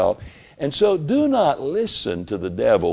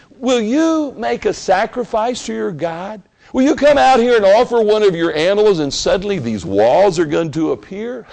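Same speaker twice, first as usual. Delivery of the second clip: loud, high mockery of believers; mocking taunt; 9 semitones higher, 4 dB louder than usual. Enemies jeering at the builders, loud and mocking. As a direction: jeer at them like the scoffers. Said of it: "they were laughing at these people".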